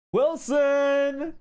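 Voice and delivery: loud, high call of a name. Retold or imitated. Me and Sunny rushing in, loudly calling for Wilson. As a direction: shout the name across the base.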